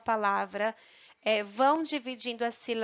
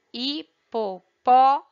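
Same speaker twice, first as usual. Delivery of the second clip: "hipopótamo" slowly, hi, po, then a loud long PÓ, then tamo; stressed syllable; 8 dB louder, slower than usual. Shown as a demonstration. Teacher showing pupils the stronger syllable, stress on PÓ. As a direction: break up hipopótamo and punch the PÓ. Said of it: "pronunciamos com mais força".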